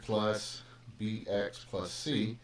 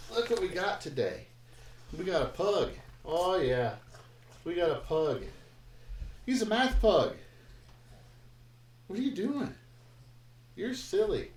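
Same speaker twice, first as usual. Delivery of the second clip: higher, cooing voice for the dog; pet talk; 7 semitones higher than usual. Real, not acted. Teacher cooing at his hyper pug, higher pet-talk. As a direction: coo at a wriggly puppy.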